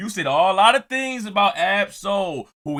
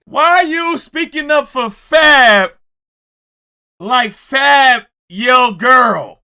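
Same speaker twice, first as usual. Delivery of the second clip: slow, loud mocking repeat of the question; mocking shout; 6 semitones higher, 9 dB louder, slower than usual. Host loudly mocks Mano's question to viewers. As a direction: drag each word out, loud and scornful. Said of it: "repeating Mano's question".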